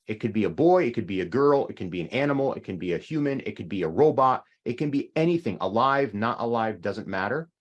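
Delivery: usual speaking voice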